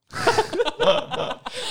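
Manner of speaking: squealing laugh rising up; high delighted shriek of a laugh. Real, not acted. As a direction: burst out in a high giggle. Reacting to coworkers' coldness.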